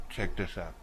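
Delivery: ordinary delivery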